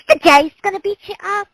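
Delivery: high, fast New York moll voice; squeaky Brooklyn gangster girl; Harley Quinn voice. Retold or imitated. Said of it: "her Harley Quinn's voice".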